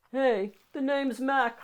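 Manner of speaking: a scruffy monster saying hello, slow. Acted. Scruffy monster greets the child, slow and loud.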